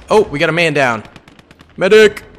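man down shout. Real, not acted. Calling for a medic in game.

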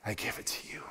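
a soft exhaled whisper of surrender; letting go on the out-breath, whispered; whispered prayer. Shown as a demonstration. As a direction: breathe the last words out in a whisper. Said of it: "and then breathing out".

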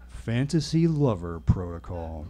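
slow, low repeat of the phrase; uneasy echo. A narrator's reading. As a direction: let it sink in, low and slow. Narrator dreads it, swallows hard.

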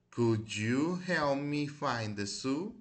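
slow model reading. Shown as a demonstration. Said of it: "ya las repetí varias veces".

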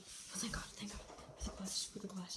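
hushed whisper while someone sleeps. Real, not acted. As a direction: whisper so nobody wakes. Sneaking by sleeping boy.

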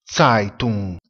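Zeitung lento, EI detto AI; pronuncia tedesca. Shown as a demonstration. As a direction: Zeitung scandito come TSAITUNG. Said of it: "si pronuncia Zeitung". (Zeitung con AI ben aperto)

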